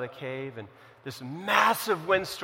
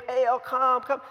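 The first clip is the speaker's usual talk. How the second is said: mock plea called out higher and louder; calling out in prayer; 6 semitones higher, 4 dB louder, slower than usual. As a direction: imitate worshippers calling on their god. Prophets praying, calling on Baal.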